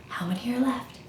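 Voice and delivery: fast surprised whisper. Acted. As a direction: gasp and whisper quickly. Gasp then whispered question.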